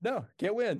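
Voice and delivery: usual speaking voice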